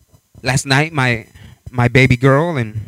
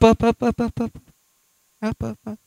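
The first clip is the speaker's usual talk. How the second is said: fading climb; 6 semitones higher, 7 dB quieter than usual. Retold or imitated.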